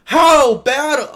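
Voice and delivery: high, loud exasperated yelling; loud furious disbelief; angry outburst. Real, not acted. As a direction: blow up in real frustration at the misplay. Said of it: "I'm actually getting so mad".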